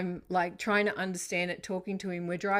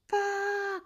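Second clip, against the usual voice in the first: screamed curse. Retold or imitated. Diving across the seat as a truck heads for her, screaming. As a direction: shriek it as if a crash is coming. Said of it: "dived across the passenger seat and screamed".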